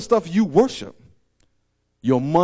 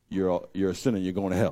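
mock preacher; 11 semitones lower, 8 dB quieter, faster than usual. Preacher retells witnessing on plane.

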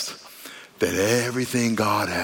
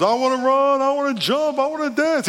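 high, hammed-up begging cry; imitating a desperate believer, each wish sung out high; mock eager pleading; 13 semitones higher than usual. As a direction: plead for a miracle in a high, excited voice. Acts out crippled man begging healing.

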